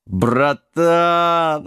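drawn-out tipsy affection; drunk giant. A narrator's reading. Giant befriends mad king, drunken drawl. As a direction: stretch the word out fondly.

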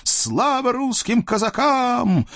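high, slow cheering imp voice; joyful devil cry. Acted. Freed devils cheer the Cossacks, high and happy. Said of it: "с криком".

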